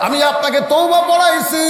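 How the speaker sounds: high, shouted admission; impassioned confession. Acted. Shepherd confesses before astonished companions, impassioned high cry. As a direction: cry the confession out for all to hear. Confession repeated at full pitch.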